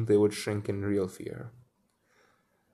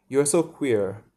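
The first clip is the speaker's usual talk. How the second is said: frankly puzzled; 4 semitones higher, 5 dB louder than usual. A narrator's reading. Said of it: "he said frankly, puzzled".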